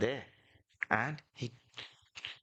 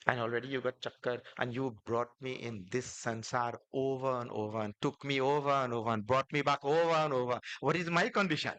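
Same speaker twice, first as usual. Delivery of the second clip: rising mock protest. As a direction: build a playful complaint to its peak. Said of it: "Kevat said".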